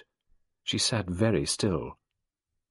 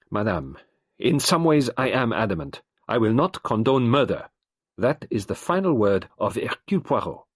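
louder, final and unyielding; Poirot giving his final word, firm and raised; adamant detective; 4 semitones higher, 6 dB louder than usual. A narrator's reading. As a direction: close the matter.

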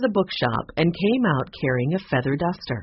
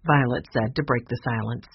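usual speaking voice

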